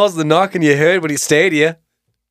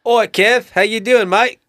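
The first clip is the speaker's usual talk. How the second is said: put-on Australian accent; 6 semitones higher, slower than usual. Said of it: "make your dad sound Australian".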